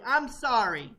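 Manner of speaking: ordinary talk, nothing marked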